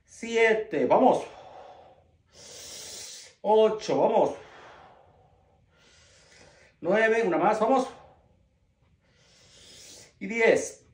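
strained, loud rep counting; lifting weights. Real, not acted. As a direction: push out each count under the weight. Counting reps while lifting weights.

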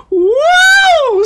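a high, loud, shrieking whoop; imitating a character's thrilled squeal; joyful scream. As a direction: let out a delighted scream.

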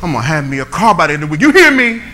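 frustrated declaration. Retold or imitated. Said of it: "I was so frustrated".

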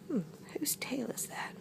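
very soft, puzzled whisper; hushed wondering. Acted. Loris spotting a tail, hushed curious whisper. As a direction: wonder it under your breath.

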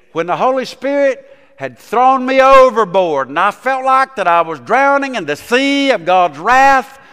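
full-force revival preaching; fiery preaching. Acted. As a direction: preach it out with fervor.

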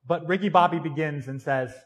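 normal talking voice

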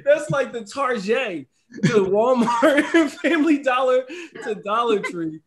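slow, loud laughing comparison. Real, not acted. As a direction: laugh through the joke.

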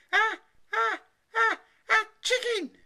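high, loud stutter bursting into the last word; horrified stammering cry. A narrator's reading. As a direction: build like a sneeze, then burst out. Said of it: "she cried".

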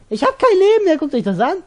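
high, loud, frantic panic; panicking gamer. Real, not acted. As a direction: yelp it out in alarm. Health gone, enemies attacking.

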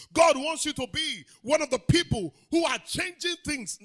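loud, rising sermon cry; full-force preaching. Acted. Pastor rousing the congregation, preaching loud and full. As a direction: preach it out to the whole church.

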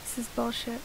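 ordinary talk, nothing marked

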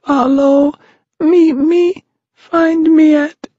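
high pleading cry; small wee shout. A narrator's reading. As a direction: call out desperately in a tiny voice. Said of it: "shouted the small, wee voice".

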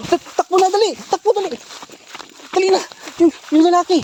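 high panicked yell to run; panicked shouting. Acted. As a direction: scream at her to flee. Man attacks, urging her to run.

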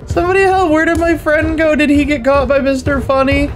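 high-pitched frantic alarm; scared, squealing gamer panic; panicked. Real, not acted. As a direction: cry out as if being chased. Chased by Mister Funny in game.

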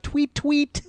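high, chirpy little put-on voice; mock bird tweet. Host replying to mean tweeters, mocking chirp. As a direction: chirp it back as a taunt.

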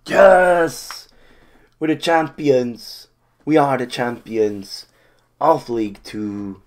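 loud, high celebration chant; triumphant shout. Real, not acted. Fan celebrating promotion, a loud triumphant roar. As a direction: roar it out for the title. Team wins the title.

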